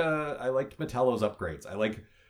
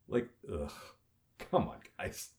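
ordinary talk, nothing marked

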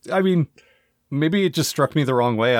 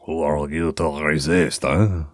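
put-on French accent; 7 semitones lower, slower than usual. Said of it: "His French accent is so thick".

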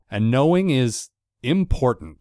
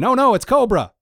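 higher, louder mock-shocked cry; hammed-up alarm at the villains; 11 semitones higher, 5 dB louder than usual. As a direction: gasp it like a cartoon kid.

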